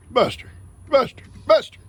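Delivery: high, loud call to Buster. Real, not acted. Farmer calling his goat Buster to pay attention. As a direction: call him over across the yard.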